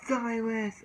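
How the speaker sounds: high call of Silas; calling a name. Retold or imitated. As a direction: yell his name to get his attention. Hugo shouting rival's name.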